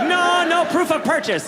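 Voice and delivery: high-pitched Dutch thief; taunting, put-on Dutch accent; gleeful refusal in cartoon Dutch; mock Dutch accent. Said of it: "That sounded Dutch".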